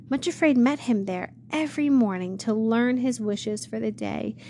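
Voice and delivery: ordinary delivery, nothing marked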